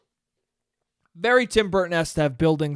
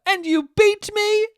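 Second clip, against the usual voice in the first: high, loud put-on kid voice; James dark moment; 4 dB louder than usual. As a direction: wail it like a hammy child actor. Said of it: "when he says that".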